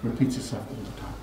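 hushed, unvoiced repeat; preacher echoing his point to the congregation in a whisper. Shown as a demonstration. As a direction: breathe it as a quiet warning. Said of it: "history does what?".

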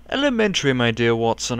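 hammed-up bad Sherlock Holmes accent; bad put-on accent. Gamer likens game to murder mystery, bad accent. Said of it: "that bad accent".

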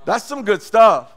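loud, slow, savoring it; emphatic exclaim. Real, not acted. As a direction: land it hard and slow. Preacher exclaiming after scripture.

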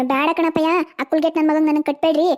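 ordinary talk, nothing marked

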